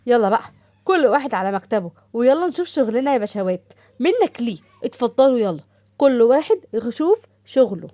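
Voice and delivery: loud, brisk-minded commands; impatient ordering. Acted. Boss sending bickering officers back to their desks, loud and impatient. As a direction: break it up with firm volume. Superior breaks up quarrelling officers.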